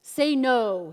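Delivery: loud, forceful order; manly commanding. Retold or imitated. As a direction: bark orders like a commanding warrior. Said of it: "he commands her in his manly voice".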